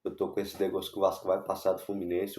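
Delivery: usual speaking voice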